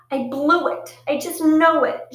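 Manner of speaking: put-on stormy teen wailing over tryouts. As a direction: wail it out like all is lost. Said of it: "she wailed".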